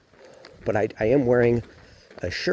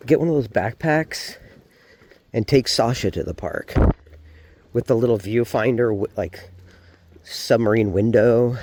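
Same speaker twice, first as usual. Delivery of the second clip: usual speaking voice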